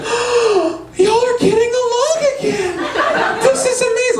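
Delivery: very high, slow, gushing voice; overjoyed mom impression. Mom finding siblings hugging, high overjoyed gushing. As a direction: gush as if near happy tears.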